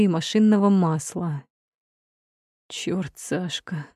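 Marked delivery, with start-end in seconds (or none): 2.7-3.9 slow, soft, hoarse and cracked; hoarse rasp. A narrator's reading. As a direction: rasp it out with a torn voice. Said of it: "Сорванным голосом хрипит он".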